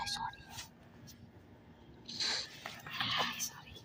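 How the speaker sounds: hushed whispered apology; whispering. Real, not acted. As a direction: whisper it so mom won't hear. Mom interrupts, whispered apology.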